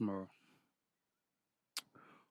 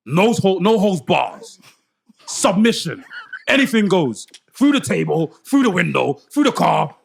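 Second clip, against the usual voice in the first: loud, raised hype promo; wrestling promo shout; 6 semitones higher, 8 dB louder than usual. Acted.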